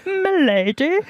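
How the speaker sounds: lightly voiced fedora guy greeting; mock fedora guy. Joking about a fedora-wearing son, mock gallant.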